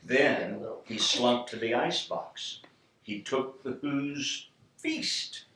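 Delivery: ordinary delivery, nothing marked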